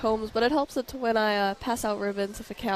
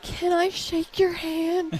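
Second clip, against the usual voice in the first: put-on higher, slow, timid little kid voice; shy little boy; 8 semitones higher, much slower than usual. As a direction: lightly play a shy six-year-old asking. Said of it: "He was like".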